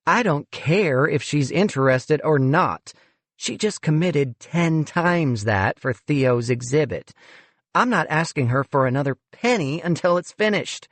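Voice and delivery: a touch of an exasperated development director; irritated fundraiser. Acted. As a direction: shut him down, fed up.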